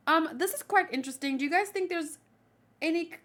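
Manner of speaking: usual speaking voice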